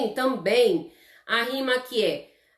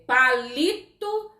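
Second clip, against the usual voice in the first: slow rhyme demo; 4 semitones higher, slower than usual. Shown as a demonstration. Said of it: "Termina tudo com lito".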